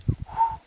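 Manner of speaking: short breathy "ukh" blown out; imitated puff of breath. Shown as a demonstration. Guide showing breath in the frosty cave, imitated puff. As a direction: blow out a sharp, airy puff.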